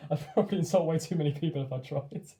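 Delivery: laughing. Real, not acted. Laughing while refusing to try a Kiwi accent.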